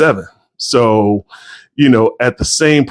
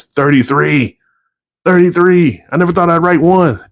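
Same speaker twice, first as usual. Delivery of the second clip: high-pitched, proud amazement; real excitement, voice jumping higher; excited disbelief; 7 semitones higher than usual. Real, not acted. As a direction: marvel at your own milestone, pitch lifted. Proudly marvels at novels completed.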